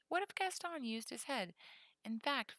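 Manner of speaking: usual speaking voice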